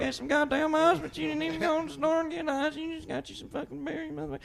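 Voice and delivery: a hammed-up drunk wife scolding her husband; drunk aunt yelling. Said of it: "they'll be talking to each other".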